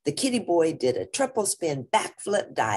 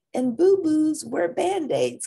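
sister voice; 12 semitones higher, 4 dB louder than usual. Acted. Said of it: "continued Jezebel".

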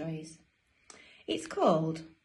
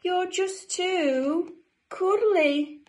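very high, slow, louder gushing; doting penguin siblings; 12 semitones higher, 5 dB louder, much slower than usual. Acted. Brothers and sisters to cuddly Dudley, high slow gushing. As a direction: draw it out high and adoring.

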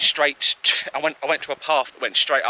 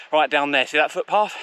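ordinary talk, nothing marked

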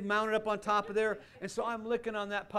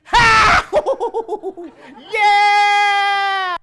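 much higher, loud whooping laugh and yell; showing the congregation excited giving; 22 dB louder than usual. Shown as a demonstration. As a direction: cheer like a giddy giver.

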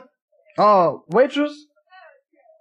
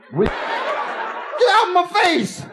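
much higher, slow, hammed-up yell; drunken snap; 10 semitones higher, slower than usual. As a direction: bark it like a mean drunk. Drunk turns on people.

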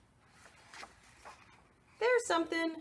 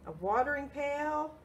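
plain reading voice

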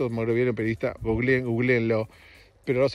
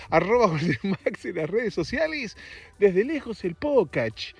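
higher, slow announcer-style show opening; podcast intro; 6 semitones higher, slower than usual. Acted. Host opening his podcast Desde Lejos as an announcer.

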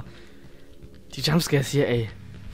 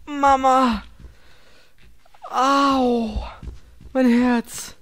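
high, shaken after a scare; shaken whimper; 7 semitones higher, much slower than usual. Real, not acted. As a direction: gasp it out, still frightened. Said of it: "ich halte das nicht aus".

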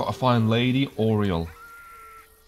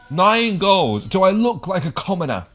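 snobbish scoff; 6 semitones higher, 4 dB louder than usual. Pretend. Scoffing at a small reward.

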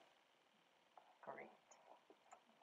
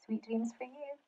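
very soft, tender bedtime wish; hushed goodnight; 3 semitones higher, 13 dB quieter than usual. Real, not acted. Goodnight at story's end.

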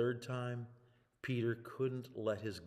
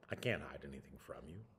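lower, hushed whisper; whispered confession; 6 semitones lower, 4 dB quieter than usual. Acted. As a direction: confess it softly, laid bare. Peter's confession to Jesus.

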